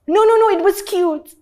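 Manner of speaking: high, loud patronising reassurance; mock sweet dismissal; fake reassurance. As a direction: cover the laugh sweetly. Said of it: "What's so funny?".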